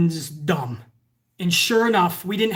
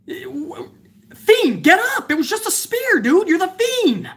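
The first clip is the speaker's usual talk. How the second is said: high-pitched, incredulous urging; squeaky-high exasperated cry; exasperated plea; 9 semitones higher, slower than usual. Pretend. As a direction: call out to a downed fighter in disbelief. Yelling at a downed wrestler.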